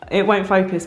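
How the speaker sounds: usual speaking voice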